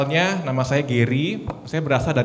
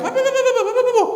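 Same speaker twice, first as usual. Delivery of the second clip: high, loud repeated yelling, laughing through it; teriak-teriak; 8 dB louder, much faster than usual. Retold or imitated. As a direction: mimic the patient's slurred cry way up high. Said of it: "Dia teriak". (meniru teriakan tinggi dan keras)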